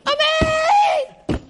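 Amen shouted very high and loud; ecstatic shout. Retold or imitated. The kids in shul screaming their Amen, loud and high. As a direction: let Amen burst out from the heart. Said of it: "and they're like".

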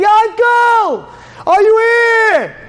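high, long-drawn shout; loud, high searching call; screaming. Acted. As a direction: scream the name, stretching it out. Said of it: "he's screaming".

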